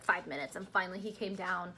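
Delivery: usual speaking voice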